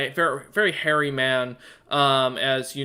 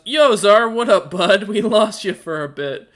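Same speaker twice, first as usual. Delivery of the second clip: a loud, higher, breezy hey-buddy call; casual bro greeting; 6 semitones higher, 7 dB louder than usual. Acted. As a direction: greet the old friend as if nothing happened. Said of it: "Caspian looks over and goes".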